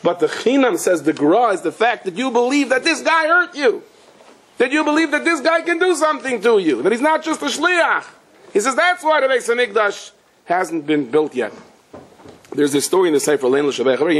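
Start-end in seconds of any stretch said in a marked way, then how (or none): 1.5-11.5 much higher, intense sermon peak; fervent preaching. Acted. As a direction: drive the message home with full fervor.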